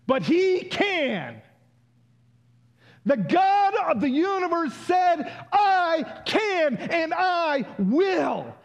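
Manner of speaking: high, loud climactic preaching; preaching at full force. Real, not acted. Preacher lifting the congregation, loud and soaring. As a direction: proclaim it with everything you have.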